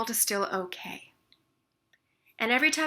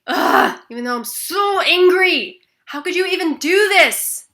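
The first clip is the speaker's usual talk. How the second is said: loud, higher, furious venting; angry tapping phrase; 9 semitones higher, 15 dB louder than usual. Shown as a demonstration. Tapping through anger at the painters, loud furious venting. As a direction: let the anger out full force. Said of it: "and say".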